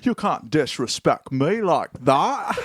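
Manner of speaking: slow, mock-indignant Scottish; worst Scottish accent. Offended Scottish panelist scolding comic, bad Scottish accent. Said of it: "That's the worst Scottish accent".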